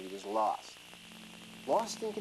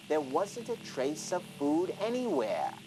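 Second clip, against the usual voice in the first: usual speaking voice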